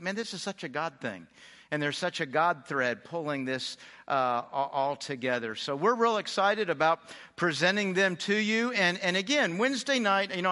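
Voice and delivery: normal talking voice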